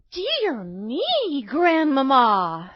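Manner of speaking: put-on high girlish wonder, drawn out; astonished little girl. A narrator's reading.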